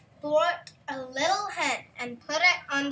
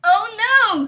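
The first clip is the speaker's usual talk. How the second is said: sharp loud no from the wife; loud refusal; 8 semitones higher, 15 dB louder than usual. A narrator's reading. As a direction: shut him down hard, high and loud. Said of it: "said the woman".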